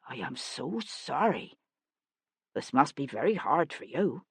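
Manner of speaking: a hint of tender pity; soft condolence. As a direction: offer sympathy you don't feel. False sympathy to actor.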